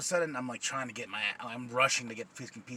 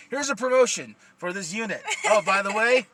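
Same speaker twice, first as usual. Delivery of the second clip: louder, higher mock corporate cheer; mock announcement; 7 semitones higher, 6 dB louder than usual. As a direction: imitate bosses handing over a prize. Mimics bosses announcing promotion.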